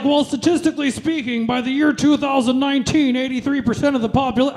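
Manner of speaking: hard sell it like a car dealer; used car salesman. Retold or imitated. Date pitching herpes to her like a used car salesman. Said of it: "like a used car salesman".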